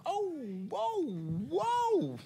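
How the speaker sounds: very high drawn-out falsetto whoa; squealed high whoa; shocked whoa. Real, not acted. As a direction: recoil in comic alarm. Shocked reaction to the idea.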